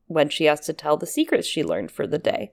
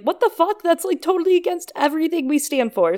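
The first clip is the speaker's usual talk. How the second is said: outraged Sam; 11 semitones higher than usual. Retold or imitated.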